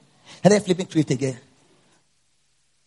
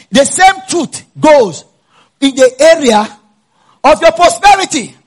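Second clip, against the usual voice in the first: loud, higher, fervent sermon delivery; preaching at full force; 7 semitones higher, 8 dB louder than usual. Acted. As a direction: build to a shouted climax about healing.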